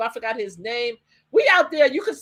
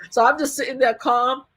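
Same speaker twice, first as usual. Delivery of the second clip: ordinary talk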